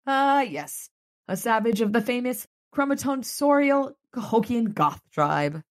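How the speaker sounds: high, arch mock-scholarly tone; sarcastic quip. Harry needling Charity about Molly's look. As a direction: deliver the joke deadpan-grand.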